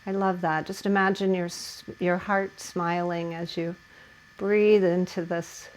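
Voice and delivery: hushed meditative teacher voice; soft guided relaxation. Acted. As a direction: ease the class into stillness. Settling class into hip stretch.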